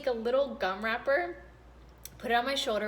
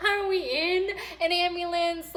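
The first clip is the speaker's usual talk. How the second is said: high question through laughter; laughing; 8 semitones higher, 4 dB louder than usual. Real, not acted. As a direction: ask it while cracking up.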